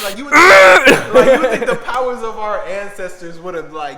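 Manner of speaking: laughing through a loud rant; loud laughing talk. Real, not acted. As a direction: say it cracking up.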